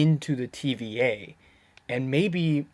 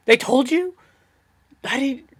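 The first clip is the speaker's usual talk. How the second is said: shocked disbelief; 9 semitones higher, 5 dB louder than usual. Retold or imitated. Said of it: "And Loki's like".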